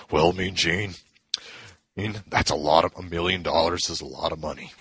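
lightly voiced wrestler, pitched low; hint of a low, hesitant wrestler impression; Hulk Hogan. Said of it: "can't do the whole impression".

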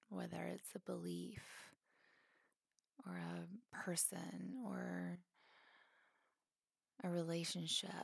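calm, spacious phrases with long pauses; guided meditation. Acted. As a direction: gently guide them into letting go.

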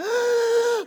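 sharp high gasp of shock. Retold or imitated. Daughter seeing the Barbie Jeep, high shocked gasp. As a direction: gasp as if seeing a dream come true.

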